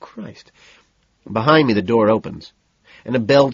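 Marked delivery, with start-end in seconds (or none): 0.0-0.5 soft, high muttered curse; a quiet exasperated curse. A narrator's reading. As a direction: mutter it under your breath.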